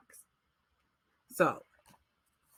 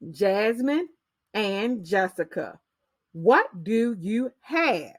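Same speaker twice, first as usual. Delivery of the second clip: slow read-aloud; slower than usual. Shown as a demonstration. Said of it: "So for example".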